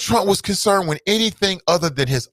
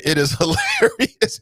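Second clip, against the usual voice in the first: laughing; 2 semitones higher than usual. Real, not acted. Laughing mid-line.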